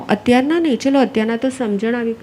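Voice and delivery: normal talking voice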